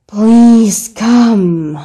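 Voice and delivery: loud, drawn-out pleading. Acted. Repeats louder to be heard.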